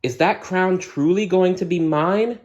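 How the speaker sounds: put-on high, eager young princess voice; a hopeful princess eyeing her crown. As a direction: ask with wide-eyed wonder. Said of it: "Aurora gasped".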